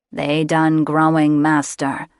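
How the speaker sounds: a misshapen beast answering its master; slow nasal creature reporting to master. A narrator's reading. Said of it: "the creature answered in a nasal voice".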